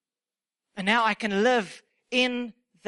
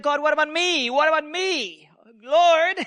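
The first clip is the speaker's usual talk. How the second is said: unhappy pleading; 3 semitones higher than usual. Acted. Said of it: "you're not very happy".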